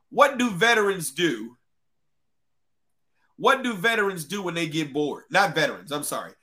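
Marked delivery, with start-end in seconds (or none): none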